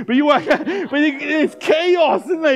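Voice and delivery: high, loud, chaotic telling; worked-up. Retold or imitated. Preacher describing the chaos of screaming children, worked-up. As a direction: raise the voice as if amid screaming kids.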